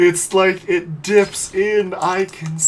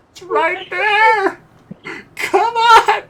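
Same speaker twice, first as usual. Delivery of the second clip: high, drawn-out wail; anguished whine; 12 semitones higher, much slower than usual. Real, not acted. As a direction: plead tearfully over a near miss. Agonising over near misses.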